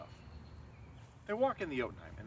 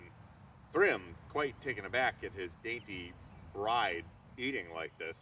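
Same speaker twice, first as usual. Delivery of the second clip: ordinary delivery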